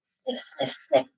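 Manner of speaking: soft sniffing noises. Acted. Reader sniffing like Detective Dog Nell.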